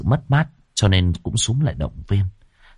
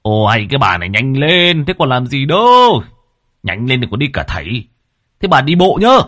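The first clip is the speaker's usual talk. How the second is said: loud, impatient husband calling out; impatient nagging shout; hurried urging; 5 semitones higher, 6 dB louder than usual. A narrator's reading. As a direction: rush her along, rising to a raised, higher voice. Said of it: "tiếng của ông Túy vội vã giục".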